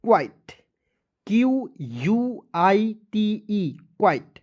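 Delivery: drawn-out letters, then quite said crisply; slow spelled demo. Shown as a demonstration. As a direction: spell out Q यू आई टी ई very slowly, then land on quite. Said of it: "का उच्चारण white के रूप में करेंगे".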